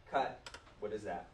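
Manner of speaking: ordinary talk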